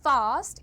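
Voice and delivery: fast as faast, loud long vowel; long A, wrong take. Shown as a demonstration. Teacher rejecting the wrong vowel, loud open fast. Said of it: "it is not".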